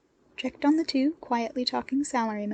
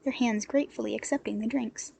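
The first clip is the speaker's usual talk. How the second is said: usual speaking voice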